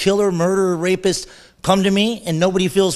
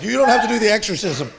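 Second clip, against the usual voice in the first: laughing self-mock; 5 semitones higher than usual. Mocking himself, laughing.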